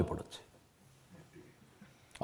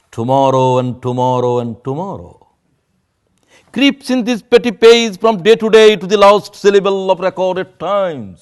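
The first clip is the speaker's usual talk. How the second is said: very slow declaimed Shakespeare; dramatic poetry recitation; 3 semitones higher, 3 dB louder, much slower than usual. Shown as a demonstration. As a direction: declaim it like a stage actor. (ধীর নাটকীয় আবৃত্তি)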